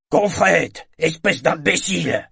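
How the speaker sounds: loud insult shouted across the room; angry yell. A narrator's reading. Torg hurle à Confrette d'un bout à l'autre du carré, cri rageur. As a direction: yell it from far away at the sailor. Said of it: "cria Torg, de l'autre bout du carré".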